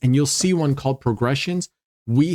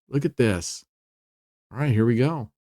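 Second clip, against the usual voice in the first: ordinary talk, nothing marked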